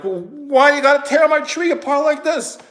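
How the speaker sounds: high, exasperated challenge; indignant protest. Retold or imitated. Confronting the crew about his torn-up tree, indignant and high.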